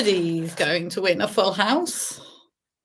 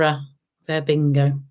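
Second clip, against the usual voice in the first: ordinary talk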